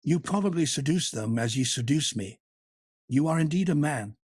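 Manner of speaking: lightly voiced woman coaxing her lover. A narrator's reading. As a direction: win him back sweetly, half teasing. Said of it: "more coaxing than an amorous kitten".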